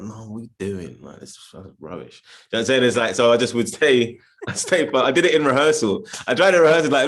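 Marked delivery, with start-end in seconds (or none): 0.0-2.2 much softer, muttered self-scolding under the breath; a quiet inner voice telling himself off. Retold or imitated. Actor quietly scolding himself for dropping the accent, muttered. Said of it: "and I'm like".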